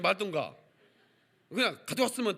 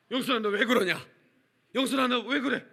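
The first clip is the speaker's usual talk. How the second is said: louder, higher worried call; mock alarmed calling; 6 semitones higher, 5 dB louder than usual. Uncle calling his fainted niece in a panic. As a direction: call her name in alarm.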